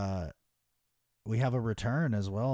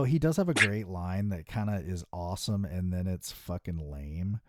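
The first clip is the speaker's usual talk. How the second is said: ordinary delivery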